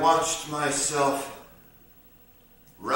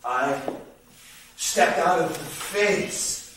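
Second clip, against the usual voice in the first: very slow, with long pauses; dreamlike telling; slower than usual. Acted. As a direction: drift through it phrase by phrase, as in a dream. Dreamlike walk into a field.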